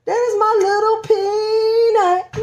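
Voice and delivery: "my little Peanut" sung slowly and high; tender high crooning; sing-song coo. Real, not acted. Cooing at baby bump.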